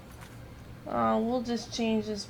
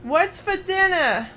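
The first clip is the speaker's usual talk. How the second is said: what's for dinner, louder and pitched up; loud dinner line; 7 semitones higher, 5 dB louder than usual. Pretend. Repeating the line to his confused friend, louder. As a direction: call it out big and hammy.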